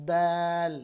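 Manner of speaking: দাল loud and drawn out, tongue behind upper teeth; dental d demo. Shown as a demonstration. Teacher modelling the letter dal, loud long dental d. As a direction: hold দাল on a level tone. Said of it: "এখান থেকে দাল উচ্চারণ করবেন".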